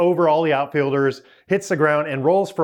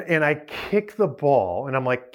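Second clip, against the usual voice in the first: ordinary talk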